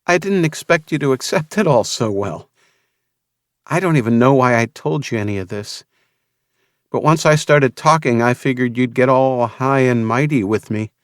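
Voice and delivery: lightly voiced young woman; Vanessa's voice. A narrator's reading.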